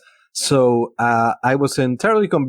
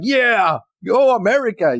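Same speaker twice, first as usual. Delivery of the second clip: higher, hammed-up cheer for America; mock patriotic cheer; 9 semitones higher than usual. Mocking patriotic movie moment.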